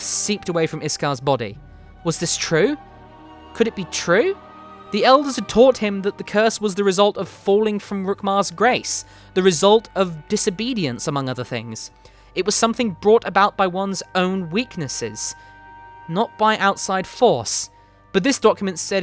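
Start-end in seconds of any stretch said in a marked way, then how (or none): none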